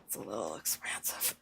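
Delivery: hushed whisper, almost secretive; quiet whispered complaint about prices; whispered aside. Real, not acted. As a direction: lean in and whisper it like a confession.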